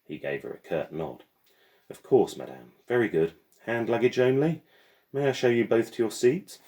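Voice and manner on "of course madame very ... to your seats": higher, louder polite mechanical porter; courteous porter ushering guests. Acted.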